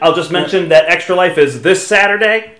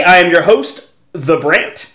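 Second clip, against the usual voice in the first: ordinary delivery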